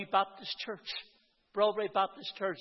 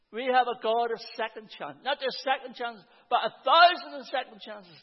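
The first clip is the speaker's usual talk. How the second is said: rising preaching emphasis; 4 semitones higher, 6 dB louder than usual. Real, not acted. Preacher builds to a climax.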